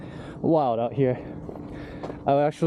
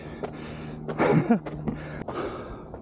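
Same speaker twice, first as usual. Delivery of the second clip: breathy laugh; 5 semitones higher, 5 dB quieter than usual. Real, not acted. Laughing alone on dark trail.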